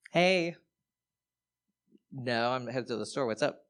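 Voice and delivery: acted-out phone chat into a pretend phone; faking an annoyed call for show; fake phone call. Comic demoing a fake phone call, mildly annoyed. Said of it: "I'm actually really good at fake phone calls".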